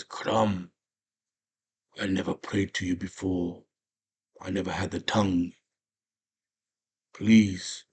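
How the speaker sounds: lightly acted solemn prayer; praying warrior. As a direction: pray haltingly, with long pauses between pleas. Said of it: "he has a little prayer".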